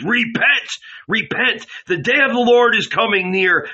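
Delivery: high, loud crying out in the desert; prophet's proclamation. A narrator's reading. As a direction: call the crowd to repent. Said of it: "John proclaimed".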